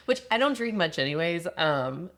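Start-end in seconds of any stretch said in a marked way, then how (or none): none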